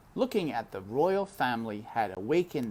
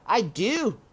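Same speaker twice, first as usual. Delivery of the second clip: loud scornful man's voice; loud mean uncle voice; Uncle sneering; 11 semitones higher, 7 dB louder than usual. Acted. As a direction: jeer at her cruelly. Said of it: "he said".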